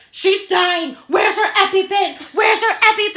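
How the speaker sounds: high, loud acted alarm; panicked shouting. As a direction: yell urgently for emergency help. Acting out panicked wedding guest.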